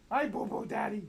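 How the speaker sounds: high cooing pet voice. Real, not acted. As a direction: coo to the dog sweetly. Baby talk to pet Hendrix.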